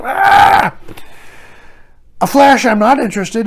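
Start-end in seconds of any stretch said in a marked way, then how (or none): 0.0-0.7 high, loud mock scream; crowd cheer impression. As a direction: shriek like a whole excited crowd. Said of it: "and everybody's".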